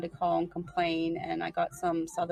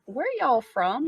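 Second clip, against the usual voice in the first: slow, raised Southern drawl; Southern lady's loud, drawn-out question; imitated Southern accent; 6 semitones higher, 5 dB louder, slower than usual. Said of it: "first time we went to Virginia Beach".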